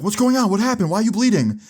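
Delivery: high-pitched rapid worried questions; imitated alarmed mom. Mom running downstairs to her bleeding son, high alarmed voice.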